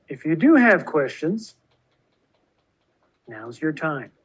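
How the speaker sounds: muffled behind a face mask; talking through a mask. Real, not acted. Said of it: "Sorry, with the mask on, it makes it hard to".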